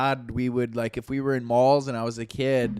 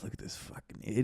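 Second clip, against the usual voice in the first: fast, hushed aside; conspiratorial whisper; 5 semitones lower, 13 dB quieter, much faster than usual. Retold or imitated. Dad to son while people watching, quick covert whisper. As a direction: whisper it quickly so the stranger can't hear. Dad's covert remark about a stranger.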